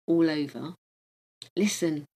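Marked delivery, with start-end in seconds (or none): none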